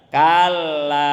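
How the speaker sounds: loud, solemn, drawn-out refusal; voice of Allah refusing. Acted. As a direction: pronounce judgment with weight, stretching it out. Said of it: "jawaban Allah bagaimana?".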